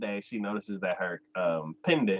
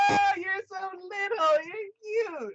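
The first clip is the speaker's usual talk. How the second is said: very high, slow cooing; cooing over a child; slower than usual. Retold or imitated. The boss cooing at the tiny girl, very high and slow. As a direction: gush over something tiny, slowly. Lady coos at shrunken girl.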